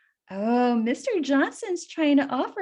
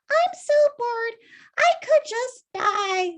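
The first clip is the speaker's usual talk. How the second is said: high, louder, dramatic sigh of a voice; bored Winnie; 10 semitones higher, 5 dB louder than usual. Acted. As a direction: lift way up and moan it. Winnie voiced in read story.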